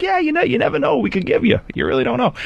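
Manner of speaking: high, coaxing salesman pitch; mock the pushy dealer talking him round; slick salesman. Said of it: "He's like".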